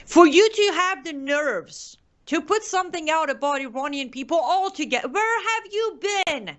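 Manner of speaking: fired up and accusing, pitch high; angry rant. Real, not acted.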